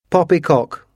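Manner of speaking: high, emphatic outcry; cried out. A narrator's reading. Washington scoffs at the housekeeper's ghost story, crying out. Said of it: "cried Washington".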